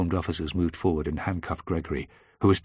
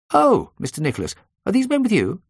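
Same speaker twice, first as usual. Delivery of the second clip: high, loud, surprised question; surprised receptionist; 14 semitones higher, 6 dB louder than usual. A narrator's reading. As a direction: pitch it up as a receptionist caught off guard. Receptionist recognises the narrator among police.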